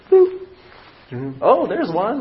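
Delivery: sudden high squeal, then a bright "oh"; a delighted wife spotting a bite; surprised squeal. Retold or imitated. Wife on the boat squealing as she hooks a fish, delighted.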